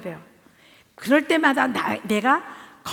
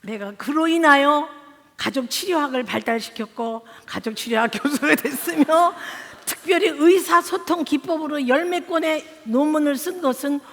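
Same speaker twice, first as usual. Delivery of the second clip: mock solemn declaration; 4 semitones higher, 5 dB louder than usual.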